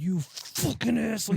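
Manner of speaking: high, drawn-out mock outrage; imitating mad buddy. Pranked buddy on learning the truth, imitated outrage. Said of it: "He was so fucking mad".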